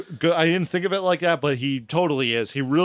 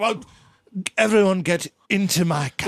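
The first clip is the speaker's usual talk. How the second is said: mock posh English accent; 5 semitones higher than usual. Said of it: "and then it's just like".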